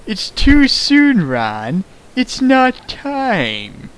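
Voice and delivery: slow, drawn-out high mock gravity; hammed-up ominous warning, very slow; solemn mistress. Yukari's solemn line, fanfic reading.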